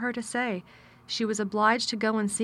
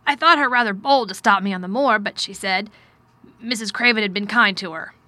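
loud, emphatic, lightly voiced man; distracted but well-meaning guardian, forceful; troubled guardian; 8 dB louder than usual. A narrator's reading. Guardian telling his ward his intentions, loud and troubled. Narrator voices Mister Craven.

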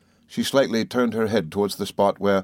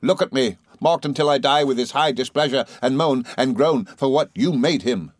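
furious accusation; 5 semitones higher than usual. Acted. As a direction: hurl it at the mother like a curse. Rosa attacking grieving mother.

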